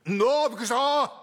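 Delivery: sudden jump up high and loud; a startled cry on being caught. Acted. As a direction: jolt awake in fright, nearly yelling.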